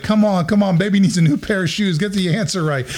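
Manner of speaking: high-pitched, urging dad cheer; root for them like a gambler calling for luck. Retold or imitated. Dad cheering on his kids at a game show, eager urging. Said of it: "I'm cheering them on".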